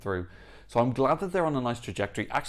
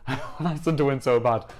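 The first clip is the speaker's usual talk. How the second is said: laughing and a bit louder; 3 semitones higher, 4 dB louder than usual. Real, not acted. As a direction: laugh through it, eager. Laughs while insisting.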